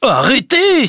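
high, loud alarmed cry. Acted. Justin crying out to stop Emma, loud and high. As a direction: stop her with a sudden shout. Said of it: "s'écria Justin".

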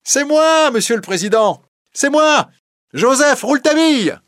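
put-on high, loud young man announcing himself; young voice calling out. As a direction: call your name over the noisy crowd. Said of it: "la même voix qui disait".

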